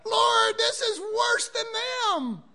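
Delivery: high, loud, slow wail to the Lord; hammed-up complaint to God; high loud mock lament; mock anguished cry.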